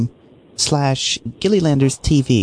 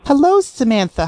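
sing-song greeting; 9 semitones higher than usual. Acted. Prank caller greeting.

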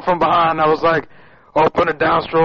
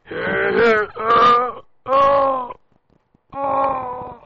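rising hammed-up grunts, building to a cry; hammed-up straining groans; mock straining grunts; 8 semitones higher, much slower than usual. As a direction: grunt it out like pushing hard. Mock straining on phone call.

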